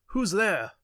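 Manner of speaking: sudden high startled cry. A narrator's reading. Old man woken, calling out in fright. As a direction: call out sharp into the dark. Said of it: "crying out".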